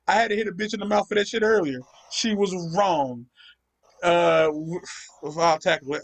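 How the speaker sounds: ordinary delivery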